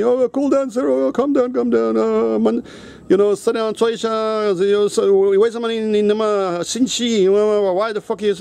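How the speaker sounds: a hint of high, pleading nurses; calming nurses. Nurses urge the furious patient to calm down, lightly voiced pleading. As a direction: coax him to sit down and calm.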